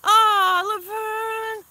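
ordinary delivery

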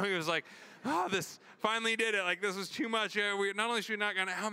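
high, fast, frantic inner voice; panicked disbelief. Retold or imitated. Husband fretting over his wife's breakdown, frantic and high. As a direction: spiral into worried panic, pitch up.

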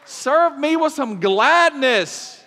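loud, high southern-style scolding; sassy southern preacher God. Pretend. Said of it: "That's the southern version of it".

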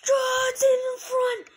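very high, loud, drawn-out call; excited play-by-play. Real, not acted. Gamer calls player in front of net, excited yell. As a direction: stretch the chance out in a high yell. Live call of scoring chance.

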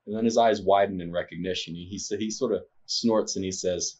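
usual speaking voice

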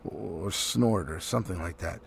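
usual speaking voice

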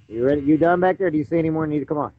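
ordinary talk

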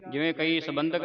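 usual speaking voice

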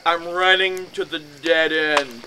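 slow, loud drawn-out realization; slow motion. Retold or imitated. Fleeing into a dead end, slowed and loud. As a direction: stretch it out like a slow-motion movie scene. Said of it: "It's like slow motion".

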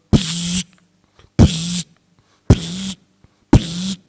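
loud, hissed unscrewing sound; screwdriver noise. Retold or imitated. Workers taking down the grocer's sign, loud mock screwdriver noise. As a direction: imitate a screwdriver turning.

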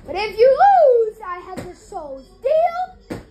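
loud opening, lifted challenge at the end; hammed-up villain bargain; devil's wager. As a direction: deliver the threat as a storybook devil. Devil's wager acted in story.

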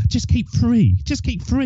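high, soft voice of a wife urging; imitated wife. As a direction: mimic a coaxing wife, pitched right up. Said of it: "my wife kept on saying".

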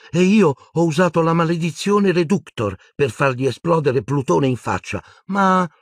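put-on high-pitched girl character voice; Luna's urgent account. A narrator's reading. Luna resumes telling Harry of the attack, urgent. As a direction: pick up the story, high and urgent.

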